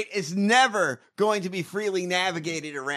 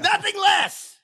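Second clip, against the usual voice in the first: booming theatrical shout, much higher; bellowed Shakespeare; 11 semitones higher, 3 dB louder than usual. Pretend. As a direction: roar the line like a stage king.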